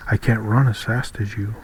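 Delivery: usual speaking voice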